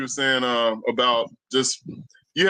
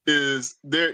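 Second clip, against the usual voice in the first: ordinary delivery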